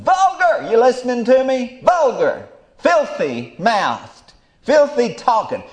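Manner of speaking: high, loud preaching cry; high loud preacher's outcry; preaching shout. Real, not acted. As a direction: cry it out at the congregation.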